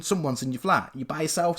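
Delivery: normal talking voice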